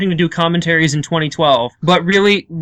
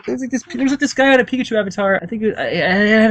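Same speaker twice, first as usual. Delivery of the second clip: normal talking voice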